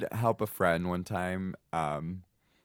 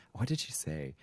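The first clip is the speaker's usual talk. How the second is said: ordinary talk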